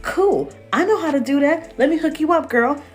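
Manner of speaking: high, eager customer-service voice; sing-song shop assistant greeting; chipper clerk. Retold or imitated. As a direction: play the overly helpful store clerk. Acting out his chipper customer service.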